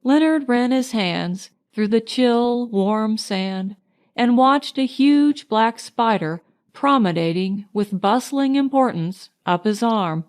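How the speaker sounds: usual speaking voice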